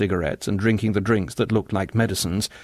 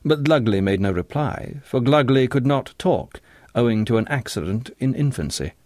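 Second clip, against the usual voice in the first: ordinary delivery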